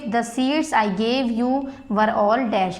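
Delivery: very slow, word by word, for students to write; slow dictation. Shown as a demonstration. Teacher reading a worksheet line to the class, slow dictation. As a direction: read it out slowly so the class can copy.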